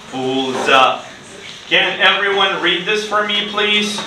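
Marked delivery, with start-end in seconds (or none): none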